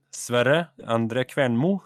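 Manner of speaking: name said slowly in Norwegian; careful, slow Norwegian pronunciation of the name. Shown as a demonstration. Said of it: "if I pronounce it in Norwegian".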